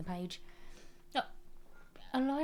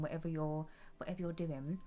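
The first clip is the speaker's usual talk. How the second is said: usual speaking voice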